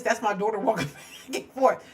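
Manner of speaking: ordinary talk, nothing marked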